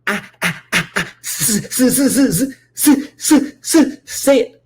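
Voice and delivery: exaggerated stuck-sound stutter; mock stutter. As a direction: stammer that climbs high and loud. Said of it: "before he can get three words out".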